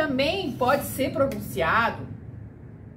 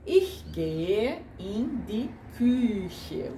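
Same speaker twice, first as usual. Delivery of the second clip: Ich gehe in die Küche said very slowly, word by word; slow demo sentence; much slower than usual. Shown as a demonstration. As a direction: spell it out slowly for learners. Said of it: "Vou para a cozinha".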